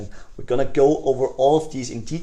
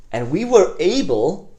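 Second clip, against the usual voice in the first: higher, louder and slow on "were"; enthusiastic; 7 semitones higher, 5 dB louder, slower than usual. Shown as a demonstration. Salesman boasting results to prospect, higher and louder. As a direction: lift "were" with excitement. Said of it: "you wanna be again enthusiastic".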